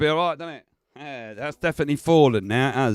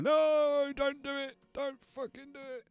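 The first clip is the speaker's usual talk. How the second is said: high, hushed pleading; nervous plea; 11 semitones higher, 9 dB quieter than usual. Real, not acted. Streamer quietly begging his computer not to glitch. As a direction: beg it under your breath.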